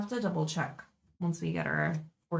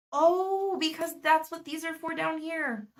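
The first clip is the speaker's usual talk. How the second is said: a real aha moment; sudden realization; 9 semitones higher than usual. Real, not acted. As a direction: burst out as it suddenly clicks. Sudden realization about the stickers.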